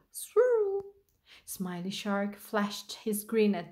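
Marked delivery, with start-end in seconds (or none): 0.1-0.5 very high, loud swoosh of a word; swooping sound word. A narrator's reading. Starfish races off across the ocean floor, high swoop. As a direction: send it flying up high.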